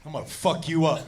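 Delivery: low, quiet mock menace; retold threat. Buddy who snapped threatens her, low menacing retelling. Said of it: "he just looks at her and he goes".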